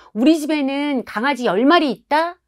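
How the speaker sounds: put-on high, loud kid's boast; boasting child. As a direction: top the friend's story like a proud kid. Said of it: "아이가 아이 친구에게 말하는". (친구에게 허풍 떠는 아이 목소리)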